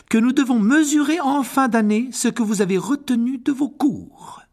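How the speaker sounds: voix aiguë, autoritaire et plus forte; professeure sévère. Acted. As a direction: imposer la consigne à la classe. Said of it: "fronça un peu plus les sourcils". (professeure autoritaire)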